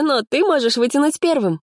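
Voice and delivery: high, sugary sweet offer; sweetly smiling. A narrator's reading. Activist lets the joker draw first, sugary. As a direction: lift it high with a sweet smile. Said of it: "сладко улыбнулась активистка".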